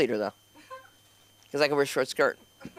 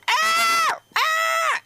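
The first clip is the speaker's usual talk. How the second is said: shrill high squawking shrieks; two piercing high bird screams; 4 dB louder than usual. Retold or imitated. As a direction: imitate the panicked bird, full volume. Bird choking on Zoom call.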